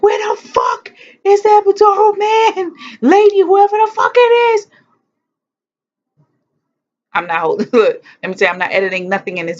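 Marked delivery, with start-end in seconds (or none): 0.0-4.7 very high, loud enraged scream; screaming for the anesthetist; furious scream. Retold or imitated. Woman in labor screaming for the epidural man, furious. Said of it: "I was like".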